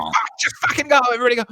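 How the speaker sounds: high yelled order to charge in; frantic yell. Retold or imitated. Cop yelling to his team to rush in, frantic. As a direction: bark it, urgent and high. Cops rushing the cabin, retold.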